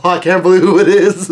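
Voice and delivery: high, loud, laughing amazement; real fan excitement; excited disbelief. Real, not acted. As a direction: burst out, thrilled by the reveal. Reader reacts to surprise reveal.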